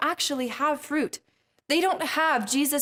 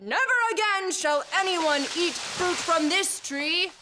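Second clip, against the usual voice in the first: higher, grand, pronouncing voice; solemn curse; 4 semitones higher than usual. Acted. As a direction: lay down a solemn biblical curse. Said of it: "He said".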